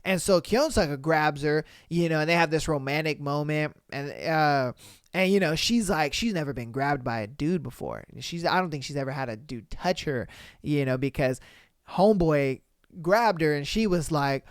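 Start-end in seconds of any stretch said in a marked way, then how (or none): none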